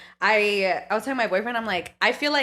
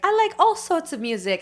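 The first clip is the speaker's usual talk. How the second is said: put-on high-pitched British accent; 8 semitones higher than usual. Host mocking their childhood video, British accent. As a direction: imitate a little kid's British accent. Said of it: "in my British accent".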